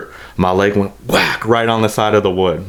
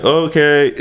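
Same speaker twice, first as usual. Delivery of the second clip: higher, drawn-out nervous okay; 7 semitones higher than usual. Retold or imitated. Retelling his buddy's nervous reply, uneasy okay. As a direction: imitate an uneasy buddy, pitched up. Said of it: "all nervous and shit".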